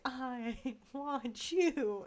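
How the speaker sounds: a lightly voiced ghostly Uncle Sam. Vlogger mimics a ghost Uncle Sam, slow and drawn out. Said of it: "just wrote down Ghost Uncle Sam".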